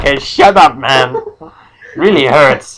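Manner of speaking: loud, high-pitched wounded retort; hammed-up whine back at the bullies; hurt protest. Noseless man telling teasers to stop, hurt protest.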